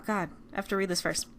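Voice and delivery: usual speaking voice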